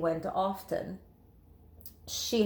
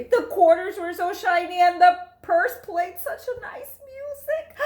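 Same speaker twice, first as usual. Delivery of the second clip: much higher and louder, near tears of joy; overjoyed rising gush; swelling delight; 9 semitones higher, 6 dB louder than usual. A narrator's reading. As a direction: gush over the treasures.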